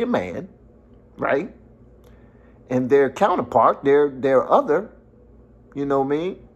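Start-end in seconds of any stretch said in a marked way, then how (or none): none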